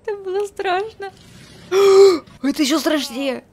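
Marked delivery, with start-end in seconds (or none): 1.2-2.4 sharp, loud gasp of fright; loud frightened gasp. Real, not acted. Scared again.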